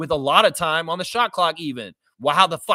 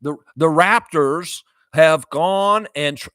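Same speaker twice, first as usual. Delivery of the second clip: slow, loud referee announcement voice; Ben Taylor impression; 7 dB louder, slower than usual. Fan mimicking a referee for viewers, slow and loud. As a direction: declaim it slowly like a mock official. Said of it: "Please impersonate Ben Taylor again".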